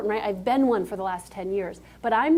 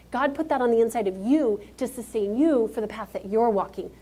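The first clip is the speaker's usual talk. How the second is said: ordinary delivery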